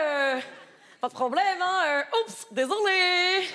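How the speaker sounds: put-on African accent creeping in; slipping into accent. Showing the casting director her country's African accent, with a laugh. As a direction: let the African accent leak out, then catch it. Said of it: "je vais faire l'accent de mon pays".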